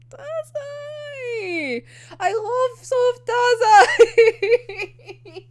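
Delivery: high, drawn-out squeal with laughter; fangirl wail. Acted. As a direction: gush it high, half laughing, half mock-crying. Gushing fan, overcome by soft character.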